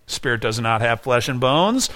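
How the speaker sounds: ordinary talk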